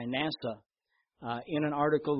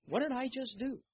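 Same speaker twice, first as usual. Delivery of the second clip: high, wondering self-quote; a startled question to himself; amazed question; 10 semitones higher, 4 dB quieter than usual. Retold or imitated. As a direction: marvel at what just happened. Retelling his surprise at the result.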